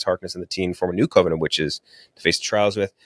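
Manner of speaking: ordinary talk, nothing marked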